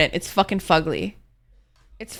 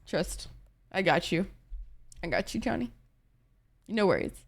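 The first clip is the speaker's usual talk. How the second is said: much softer, easy reassurance; 3 semitones lower, 12 dB quieter than usual. Real, not acted. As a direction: soothe him gently. Reassuring Johnny.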